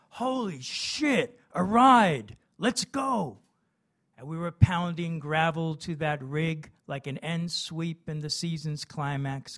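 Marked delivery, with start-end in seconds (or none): 0.1-3.4 high, drawn-out holler; whooping yell at catching a ride; excited shout. A narrator's reading. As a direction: shout it out with elation. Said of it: "and shouted".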